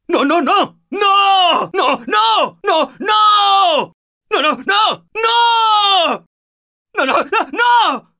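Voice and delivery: high, frantic screams of no; panicked screaming. Acted. Teammate dying, panic.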